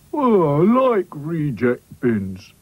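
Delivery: very slow, drawn-out doll voice; glum Sad Sack. Acted. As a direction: drag every word. Sad Sack's voice.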